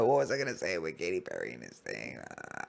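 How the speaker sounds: low, soft self-directed mumble; quietly asking yourself; thinking aloud. Real, not acted. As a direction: drop right down, searching for the lost thought. Thinking aloud after losing the thread.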